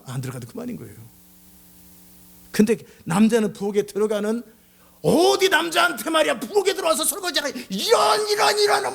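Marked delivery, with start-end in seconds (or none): 5.0-8.9 high, shouted indignation rising to sputtering; hammed-up fury, pitched way up; acted outraged rant. Husband told to do dishes in the kitchen, acted outraged rant. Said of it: "막 분노가 치밀고".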